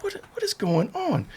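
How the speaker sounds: very soft, high whisper; retold nervous whisper to yourself; hushed bewilderment. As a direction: stammer it under the breath.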